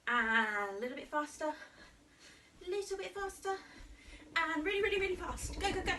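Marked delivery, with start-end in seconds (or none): 2.7-5.9 put-on energetic kids' coach speeding up jogging; excited urging. Shown as a demonstration. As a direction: egg the kids on, go go go. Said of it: "coaching faster jogging".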